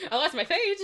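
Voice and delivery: high, loud, laughing admission; amused, rising squeal; giggly confession. Real, not acted. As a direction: blurt it out through giggles. Giggling over losing her page.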